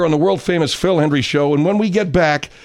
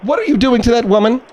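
loud, high indignant scold; 6 semitones higher, 4 dB louder than usual. Acted. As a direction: demand it in outrage. Host scolds caller loudly.

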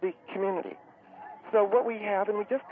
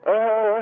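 long, loud groaning exhale; hammed-up gasp for air; deep breathing; 7 dB louder than usual. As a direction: heave out a big noisy breath. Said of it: "breathe deep, honey".

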